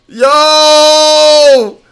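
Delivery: high, loud sung-out donation call; hype shoutout. Real, not acted. Streamer hyping a big donation, loud and high singsong. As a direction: belt it out like a ring announcer.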